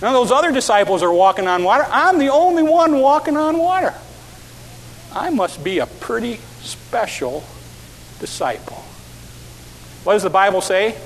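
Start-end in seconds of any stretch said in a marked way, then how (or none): 1.9-8.8 lightly voiced boastful Peter, a bit higher; a hint of smug Peter; proud Peter. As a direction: puff up like a proud disciple.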